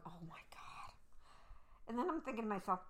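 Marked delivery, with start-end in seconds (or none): 0.1-0.9 breathless whispered oh my God; hushed stunned whisper. Retold or imitated. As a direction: whisper it under your breath. Stunned at the table.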